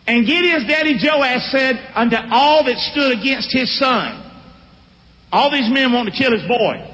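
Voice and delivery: ordinary talk, nothing marked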